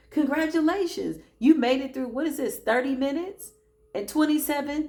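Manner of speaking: celebrating the finish line; celebratory cheer. Real, not acted. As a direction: cheer them on for making it. Celebrating listeners finishing session.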